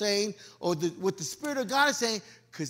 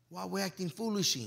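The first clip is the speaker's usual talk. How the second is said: normal talking voice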